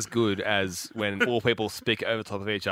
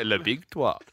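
quick mock French phrase; French accent with a light touch; slower than usual. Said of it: "You can always do a French accent".

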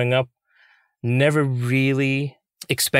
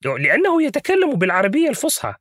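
high, quick puppet voice; Grover in Arabic; 11 semitones higher, much faster than usual. Shown as a demonstration. Puppeteer shows the Arabic version, high fast puppet voice. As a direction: rattle off formal Arabic in a high Muppet voice. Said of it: "but in Arabic, he loses that accent".

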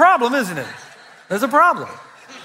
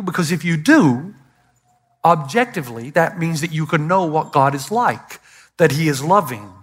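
normal talking voice

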